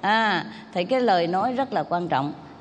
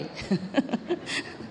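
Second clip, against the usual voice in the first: soft laugh; 4 semitones higher, 8 dB quieter than usual. Real, not acted.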